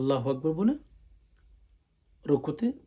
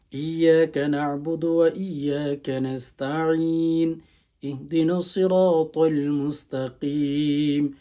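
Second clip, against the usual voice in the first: slow, louder, melodic tilawat; Quranic recitation; 4 dB louder, much slower than usual. Shown as a demonstration. Imam teaching the Fajr prayer, chanting Fatiha slowly. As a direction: chant the Fatiha with long held vowels.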